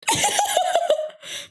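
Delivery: high-pitched burst of laughter; high cackle. Real, not acted. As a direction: cackle at your own pettiness. Laughs at own pettiness.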